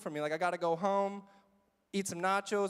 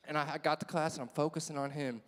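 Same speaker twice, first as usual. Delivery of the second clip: usual speaking voice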